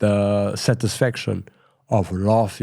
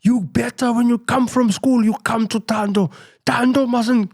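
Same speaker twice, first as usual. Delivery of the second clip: much higher, louder, menacing mimicry of the brother; mock threat; 13 semitones higher, 4 dB louder than usual. Narrator mimics brother threatening kids, high menacing voice. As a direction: play the brother warning the kids off, high and forceful. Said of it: "threaten them".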